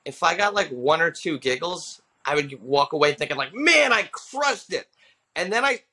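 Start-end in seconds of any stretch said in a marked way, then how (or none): 3.5-4.9 high, drawn-out cocky boast; mock triumph. New comic walking away from an open mic, mock triumph. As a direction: crow it slowly, hugely pleased with yourself.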